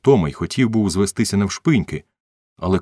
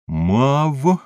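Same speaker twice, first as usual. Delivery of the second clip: higher, drawn-out dictation to oneself; spelling out syllable by syllable; 9 semitones higher, 3 dB louder than usual. A narrator's reading. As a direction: sound it out letter by letter, pitched up. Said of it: "вимовляв букву за буквою". (вимовляючи по буквах)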